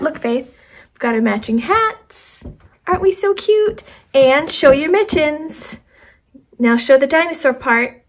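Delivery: high, loud excited talk to a little one; excited parent. Real, not acted. Parent showing off matching hats and mittens to child, excited.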